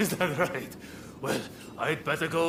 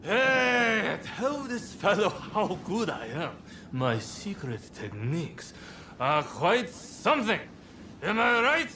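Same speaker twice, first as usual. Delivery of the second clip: tipsy bragging, calling out to strangers; half-drunk swagger; 4 semitones higher than usual. Acted. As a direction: boast like a man who's had too many. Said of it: "He's three sheets to the wind".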